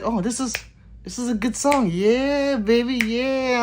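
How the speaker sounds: high, mock-excited groove; acted enthusiasm. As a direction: play someone getting into a song. Acts out enjoying the song.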